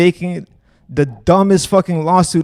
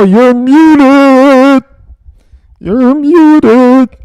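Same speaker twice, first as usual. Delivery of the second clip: louder, higher, crowing You're muted at him; loud gloating taunt; 6 semitones higher, 9 dB louder, much slower than usual. Real, not acted. Streamer gloats at the muted guest, loud and crowing. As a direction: rub it in that he can't answer.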